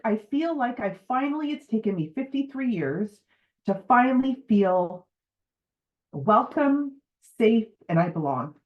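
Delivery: tearful and grateful, with real feeling; emotional, near tears. Real, not acted. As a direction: push through the words while close to tears. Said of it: "I'm gonna cry".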